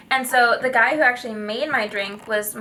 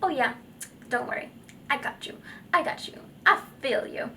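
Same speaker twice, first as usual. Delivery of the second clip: inner voice; 3 semitones higher, 4 dB quieter, faster than usual. Pretend. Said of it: "the little person talking in my head".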